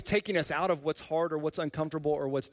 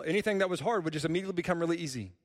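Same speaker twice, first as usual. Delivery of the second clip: ordinary delivery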